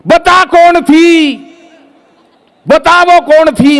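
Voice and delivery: high, slow, drawn-out suspicious wife voice; nagging wife. Acted. Wife interrogating the comedian about the woman, shrill and drawn out. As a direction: whine the question like a jealous wife. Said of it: "एक ही सवाल पूछ रही है".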